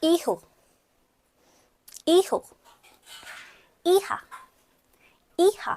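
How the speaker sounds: ईहो and ईहा with silent h and j said as h; correct Spanish. Shown as a demonstration. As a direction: model reading, ईहो ईहा. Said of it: "आप try करो एक बारी". (ईहो, ईहा: ज को ह की तरह)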